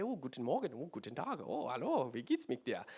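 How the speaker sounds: soft, careful learner's German greetings; a hint of an eager beginner rehearsing German; practising German. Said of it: "I would practice this every day".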